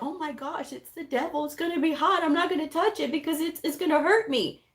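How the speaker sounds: mock child reacting in awe; child's voice. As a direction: play a frightened little kid. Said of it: "they're like".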